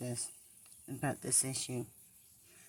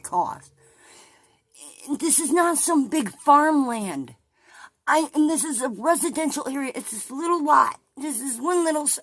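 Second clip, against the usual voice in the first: loud, high, indignant shouting; furious rant; 7 semitones higher, 9 dB louder than usual. Real, not acted. Vlogger raging at her brother over the house sale, loud angry rant. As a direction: hammer home one little house in outrage.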